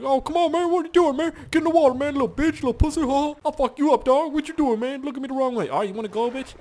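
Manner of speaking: high-pitched trash-talking shark; fierce shark. Pretend. Player voicing a shark picking a fight, high mock-aggressive voice. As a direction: taunt rapidly in a high, squawky tough-guy voice. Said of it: "He's looking pretty fierce, so he's like".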